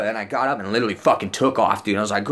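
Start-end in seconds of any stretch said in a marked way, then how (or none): none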